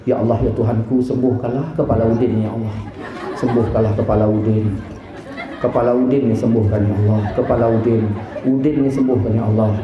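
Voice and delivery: low, murmured pleading to God; hushed, repetitive low supplication; child's earnest prayer. Retold or imitated. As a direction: imitate a son praying over his father. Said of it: "Pakai anak itu".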